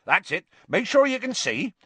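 loud, high encouraging call. A narrator's reading. Hagrid arranging his class at the fence, calling out. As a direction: marshal the group from the front. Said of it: "he called".

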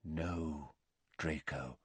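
low, very soft correction; quiet. A narrator's reading. Dumbledore correcting Draco, quiet. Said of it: "said Dumbledore quietly".

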